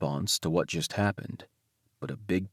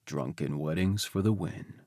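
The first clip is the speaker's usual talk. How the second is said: lower, quieter echo of the same line; agreeing husband; 6 semitones lower, 7 dB quieter than usual. Acted. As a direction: agree fondly, dropping the voice down. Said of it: "I agree".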